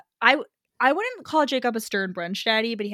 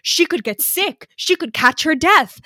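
mock fretting Jacob; 8 semitones higher, 8 dB louder than usual. Sister mimics Jacob fussing over soaked Eve, mock-worried. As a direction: fuss over her like a worried hen.

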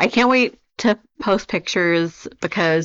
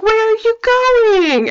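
put-on much higher, slow little-girl plea; 5 dB louder, slower than usual. Retelling the movie girl to a friend, high slow voice. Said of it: "she's like".

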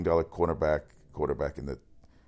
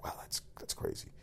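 breathy whispered aside of awe; whispered wow; 14 dB quieter than usual. Retold or imitated. Host retelling his own reaction, in a whisper. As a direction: whisper it, awestruck. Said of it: "I just was like".

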